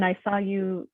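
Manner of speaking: usual speaking voice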